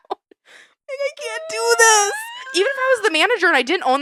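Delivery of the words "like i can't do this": high, slow, hammed-up crying wail; fake-sob it up high and drawn out; comic pretend breakdown over a broken machine; mock crying. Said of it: "walk into work and cry".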